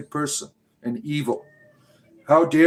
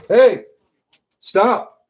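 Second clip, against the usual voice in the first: real parental yell; 8 semitones higher, 7 dB louder than usual. Real, not acted. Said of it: "I gotta yell at my kids".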